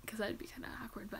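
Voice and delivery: fast whispered aside; whispering. Real, not acted. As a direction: whisper it to yourself. Embarrassed aside.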